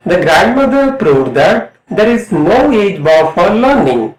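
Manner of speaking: normal talking voice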